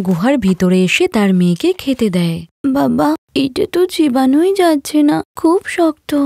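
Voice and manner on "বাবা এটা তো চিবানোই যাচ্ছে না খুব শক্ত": put-on high child's whine about hard bread; a five-year-old struggling to chew; complaining little girl. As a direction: daughter tells father the bread is too hard, childlike.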